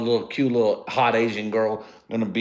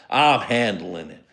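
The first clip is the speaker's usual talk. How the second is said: ordinary delivery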